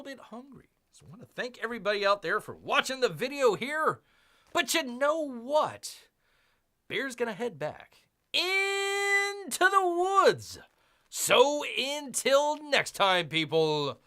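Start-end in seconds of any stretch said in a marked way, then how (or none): 4.5-14.0 slow, higher, louder bear mascot sign-off; a hammed-up mascot farewell; Bear persona voice. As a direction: speak as the big friendly Bear, drawn out. Vlogger voices Bear persona signing off.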